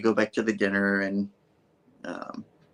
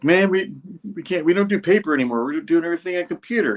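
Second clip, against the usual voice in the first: higher, fast mock complaint; imitating Jonah; 8 semitones higher, faster than usual. Jonah griping to Ben about the paper, quick high mock gripe. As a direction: rattle it off like a fussy boss. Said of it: "Jay Jonah's like".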